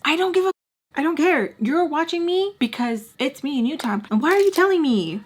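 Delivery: high-pitched, exasperated pushback; indignant. Real, not acted. Indignant reply to the viewer's complaint.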